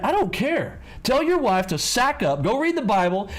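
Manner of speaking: fired-up lecture voice; high, heated scolding; heated rant. Real, not acted. Heated rant about husbands.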